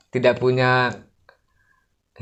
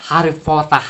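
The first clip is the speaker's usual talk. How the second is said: mock-British "Harry Pottah", amused; British-style open ending on Potter; British accent; 3 semitones higher than usual. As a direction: put on a playful British accent. Mimics film's British pronunciation.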